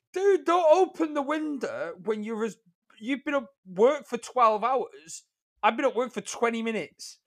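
high, drawn-out protest; exasperated plea. Retold or imitated. Tired driver begging bubbly customer to leave off, exasperated. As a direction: plead slowly, pitched well up, at wit's end.

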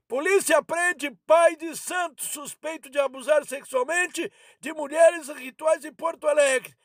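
normal talking voice

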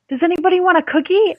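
quick, high-pitched hostess imitation; crazy mother. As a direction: mimic a mum suddenly offering treats, high and fast. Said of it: "she's so crazy".

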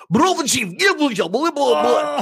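high-pitched imitation of a woman's Russian; mock Russian gibberish. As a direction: rattle off fake Russian in a high voice. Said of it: "This woman starts speaking Russian".

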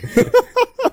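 loud high laugh; high burst of laughter. Real, not acted. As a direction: break into laughter. Laughs at mishearing. (tawa keras melengking)

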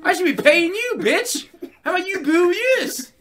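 high, loud mock-angry rant; berating the crowd. Comic telling off a three-dollar audience, shouted rant. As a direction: yell back at the booers in hammed-up outrage.

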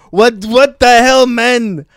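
loud, high, slow French indignation; outraged French accent. Retold or imitated. French fan blames him for ruining his moment, outraged French accent. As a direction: complain hotly in a thick mock French accent. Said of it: "The accent alone is pretty fun".

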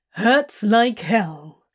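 slow, drawn-out mock echo; Crowley mocking. Demon throwing Hob's words back, mocking. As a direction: parrot it back with derision. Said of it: "Crowley says in a mocking tone".

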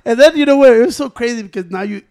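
ordinary talk

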